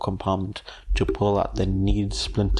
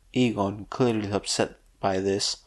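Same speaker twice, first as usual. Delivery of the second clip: ordinary delivery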